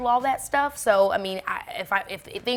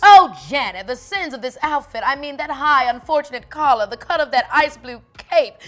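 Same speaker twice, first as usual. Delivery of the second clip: louder, theatrical society diva; haughty grand lady; 4 semitones higher, 8 dB louder than usual. Acted. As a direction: gleefully tear her outfit apart. Lady Miss monologue.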